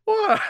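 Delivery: loud high-pitched laughing fit; loud high laughter. Real, not acted. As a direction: laugh hard, can't stop.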